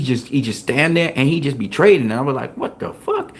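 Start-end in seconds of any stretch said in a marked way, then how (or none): 2.6-3.3 high, hushed squeak of replayed shock; stunned disbelief. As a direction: act out amazement softly, voice way up. Acting out his stunned reaction.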